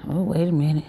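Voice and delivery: normal talking voice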